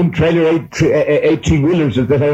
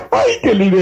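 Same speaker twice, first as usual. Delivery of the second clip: joyful laugh; 8 semitones higher than usual. Real, not acted. Preacher overcome, laughing with joy.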